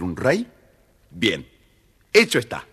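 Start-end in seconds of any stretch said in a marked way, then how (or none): none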